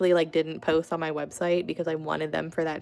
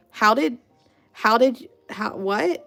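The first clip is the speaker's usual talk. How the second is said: halting, higher-pitched disbelief; retold bafflement; 5 semitones higher, 5 dB louder than usual. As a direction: stammer it as if nothing adds up. Retold confusion as sum fails.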